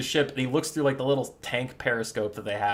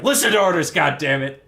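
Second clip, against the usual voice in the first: angry captain; 2 semitones higher, 7 dB louder than usual. Acted. Captain silencing his gunner, mock-angry shout. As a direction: shout down the subordinate in mock anger. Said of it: "And the captain's like".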